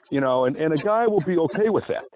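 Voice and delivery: ordinary delivery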